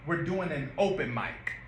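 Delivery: "open mic" said slowly with a clean "open"; correct open mic. Shown as a demonstration. Director showing the actor the right wording, "open mic". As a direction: demonstrate "open mic", not "opening". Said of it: "pause right there".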